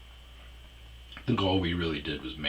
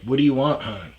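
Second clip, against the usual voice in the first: mock exasperation; 8 semitones higher, 11 dB louder than usual. Exasperated asking over her panic.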